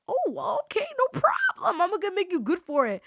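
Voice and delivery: mock impressed. Replying to a bold promise of dua, mock impressed. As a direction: play up an obliging, high-pitched answer.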